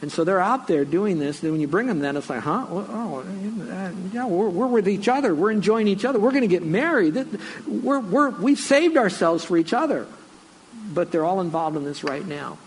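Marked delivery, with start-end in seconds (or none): 2.4-10.1 high-pitched, stammering mock protest; flustered couple's excuses. Engaged couple justifying themselves to their pastor, high flustered mock protest. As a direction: mimic a defensive couple, starting hesitant then insisting in a raised pitch.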